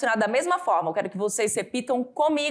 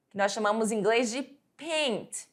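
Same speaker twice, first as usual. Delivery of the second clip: ordinary talk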